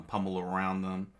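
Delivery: usual speaking voice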